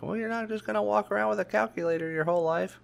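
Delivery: high, quick nagging imitation; mocking scolding adult. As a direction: parody a lecturing grown-up in a high-pitched rush. Mimics adults scolding about calculators.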